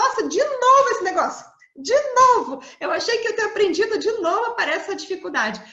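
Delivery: exasperated complaining. Acted. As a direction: gripe as if it has happened yet another time. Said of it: "a gente fica reclamando".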